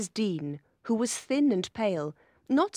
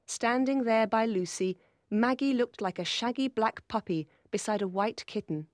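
ordinary delivery, nothing marked

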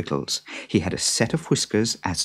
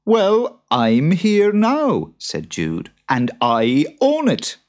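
higher, louder, slow stubborn reply; a touch of a stubborn farmer; 9 semitones higher, 5 dB louder, slower than usual. Acted. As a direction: dig in your heels. Jude arguing.

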